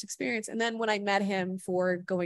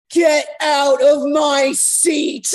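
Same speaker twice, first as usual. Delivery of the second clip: slow, loud, higher imitated yell; retold scream; 7 semitones higher, 10 dB louder, much slower than usual. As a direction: bellow it out like a drunk star. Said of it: "scream at me to get out of his seat".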